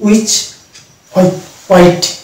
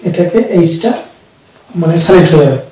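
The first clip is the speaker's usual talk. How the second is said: usual speaking voice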